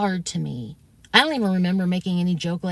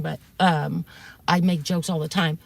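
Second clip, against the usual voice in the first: ordinary talk, nothing marked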